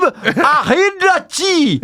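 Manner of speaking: a put-on Scottish accent, getting angry; thick Scottish accent. Prank caller to restaurant, thick Scottish accent. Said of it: "put on a thick Scottish accent".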